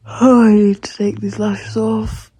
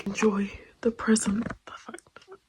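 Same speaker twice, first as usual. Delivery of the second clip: hushed late night whisper; 3 semitones lower, 7 dB quieter than usual. Real, not acted. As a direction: whisper so no one wakes.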